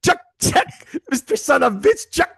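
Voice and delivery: high, loud exaggerated Russian accent; mock Russian accent. Correcting his co-host's impression of the villain: exaggerated Russian accent. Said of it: "No, he's like".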